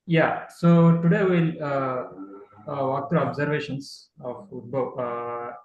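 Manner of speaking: projected opening, strongest at the start. Real, not acted. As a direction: speak up so the listeners can hear. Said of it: "you have to be louder".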